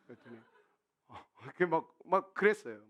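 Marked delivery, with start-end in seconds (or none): none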